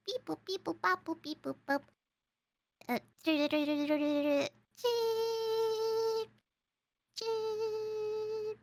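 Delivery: electronic beeps, then long screeching tones; fax machine imitation. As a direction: beep like a dialing fax, then hold the screech. Imitating fax machine for guessing game.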